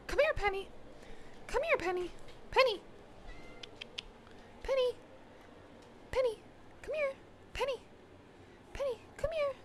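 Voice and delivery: very high, soft sing-song pet call; calling the dog. Real, not acted. Calling Penny over again and again, high cooing call. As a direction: coo her name up high to lure her.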